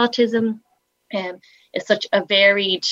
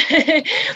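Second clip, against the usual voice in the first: light laugh; 9 semitones higher than usual. Real, not acted. Laughs at own joke.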